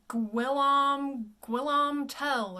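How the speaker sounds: "Guillaume" misread loudly as gwill-AHM, "Tell" as English; drawn-out spelling-based "Guillaume Tell", with a W; bizarre misreading. Shown as a demonstration. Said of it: "The next one looks like".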